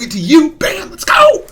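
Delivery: high hyped shout building to the end; pumped up. Real, not acted. Gamer bringing the new streak to viewers, pumped up. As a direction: build fast into a soaring hype cry.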